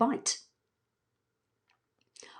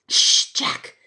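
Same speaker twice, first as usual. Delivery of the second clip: whispered shush. Acted. Evie shushing Jack, whispering. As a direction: hush him up. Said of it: "be quiet".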